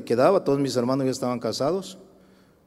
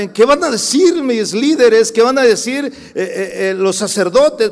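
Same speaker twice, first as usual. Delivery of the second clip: voicing Saulo's worry; 9 semitones higher, 10 dB louder than usual. Saulo's imagined worry, voiced.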